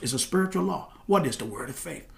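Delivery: usual speaking voice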